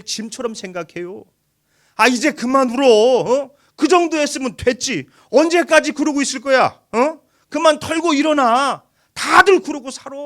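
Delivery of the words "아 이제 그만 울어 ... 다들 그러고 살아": loud, high nagging voice; a nagging friend telling a mourner to stop crying, loud and impatient; impatient scolding. Retold or imitated. Imitating people who dismiss grief.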